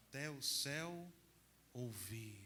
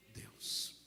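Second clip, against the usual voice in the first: whispered Deus. Real, not acted. Priest hushing as he names God. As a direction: breathe the word Deus. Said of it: "unvoiced final word".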